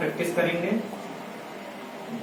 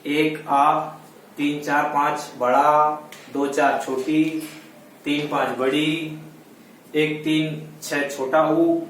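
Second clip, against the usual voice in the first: slow, drawn-out dictation; slow motion; slower than usual. Shown as a demonstration. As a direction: say each dot number slowly. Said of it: "slow motion में बोलकर बताता हूँ".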